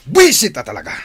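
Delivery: loud angry outburst, pitch up; furious shout. Acted. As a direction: explode at the name on every donation. Boss fuming at donor names.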